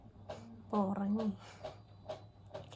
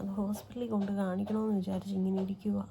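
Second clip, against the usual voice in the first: ordinary delivery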